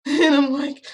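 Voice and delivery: laughing. Real, not acted. Laughs at his creepy answer.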